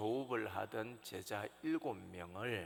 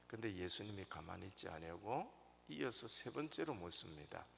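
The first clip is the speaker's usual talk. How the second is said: usual speaking voice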